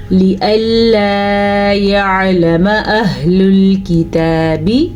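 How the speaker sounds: slow, measured tajweed recitation; careful teaching recitation; Quranic recitation. Shown as a demonstration. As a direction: recite it slowly, drawing out the vowels. Recites verse.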